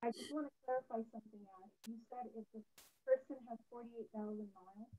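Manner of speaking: muffled voice, as if through a hand. Real, not acted. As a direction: speak with your hand over your mouth. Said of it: "it's very muffled. I can barely hear you".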